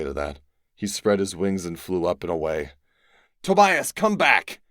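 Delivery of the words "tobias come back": higher, louder call after him; urgent shout across a distance. A narrator's reading. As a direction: call to a friend flying away. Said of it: "I called to him".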